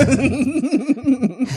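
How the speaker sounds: high breathy laughing fit; high giggle. Real, not acted. Remembering Lucy's face, high giggle. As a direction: giggle at the awkward moment.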